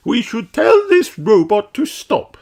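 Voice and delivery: a queen urging action; fairy queen voice. A narrator's reading. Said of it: "says Queen Thistle".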